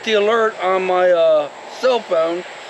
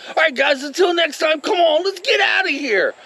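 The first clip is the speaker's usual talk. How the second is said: much higher, lively outro; sign-off pitched way up, then redone; 10 semitones higher than usual. Real, not acted. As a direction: toss off the goodbye up high and bright. First try at the sign-off.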